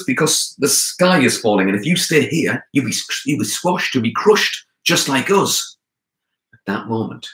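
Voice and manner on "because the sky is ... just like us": urgent warning. A narrator's reading. Anansi warns leopard of falling sky.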